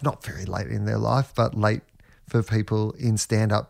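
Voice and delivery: ordinary talk, nothing marked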